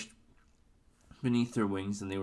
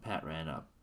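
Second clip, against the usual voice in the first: plain reading voice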